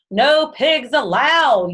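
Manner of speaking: slow, high, loud yell; shouting. A narrator's reading. Eugenia yelling at the pig, slow and loud. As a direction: stretch each word out in a shout. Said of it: "Eugenia shouted".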